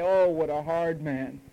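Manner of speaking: slow, whiny imitation of a sulking listener; sulky mock grumble; mock complaint. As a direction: drag it out like you're hard done by. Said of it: "you say".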